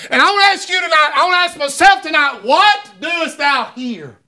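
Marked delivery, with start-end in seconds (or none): 2.4-4.2 slow searching question. Acted.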